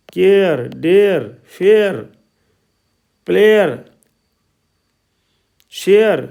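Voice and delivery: word drill, "केयर", "फेयर", "शेयर" with ए gliding into r; ए-glide vowel demo. Shown as a demonstration. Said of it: "ए का उच्चारण ए की ध्वनि में". ("फेयर", "शेयर" में ए की ध्वनि साफ़)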